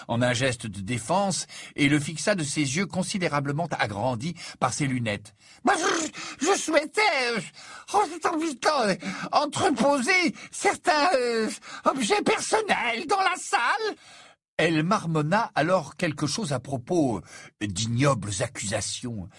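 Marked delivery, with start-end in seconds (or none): 5.6-14.0 very high, slow, stammering seer; a dithering prophetess confessing reluctantly; flustered seer. Acted. Trelawney admits hiding things.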